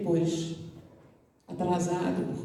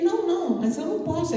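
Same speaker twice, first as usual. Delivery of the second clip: higher, hesitant refusal; flustered young man; 7 semitones higher, faster than usual. Retold or imitated. The shy student refuses to go in with the girl, high and flustered. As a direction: protest nervously.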